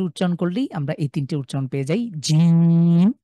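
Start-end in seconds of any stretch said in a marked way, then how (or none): 2.2-3.1 জিম said firmly with a hard J; hard jeem. Shown as a demonstration. Teacher shows the firm জিম to students. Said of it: "একটু শক্ত করে".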